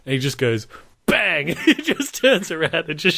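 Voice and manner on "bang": sudden higher "bang" for a gunshot; imitated gunshot. Retelling the shooting scene, sudden gunshot bang. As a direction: punch out a mock gunshot.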